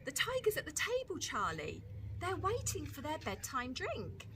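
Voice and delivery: high, cheeky little sister; Lola's little-girl voice. Acted. Lola voiced by reader.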